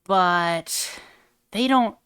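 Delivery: usual speaking voice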